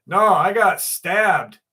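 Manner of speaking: stabbed stranger's slow, matter-of-fact reply; deadpan reveal. Retold or imitated. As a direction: drop it slowly like it's nothing.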